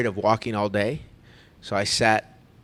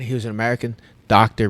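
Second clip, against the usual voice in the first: normal talking voice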